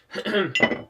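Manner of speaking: harsh choking cough. Real, not acted. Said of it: "sorry, I'm choking".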